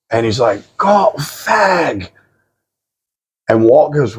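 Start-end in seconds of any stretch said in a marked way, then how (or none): 0.8-2.1 high, drawn-out mock British voice; each word stretched out high; slowed-down repeat. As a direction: imitate the stranger saying it very slowly.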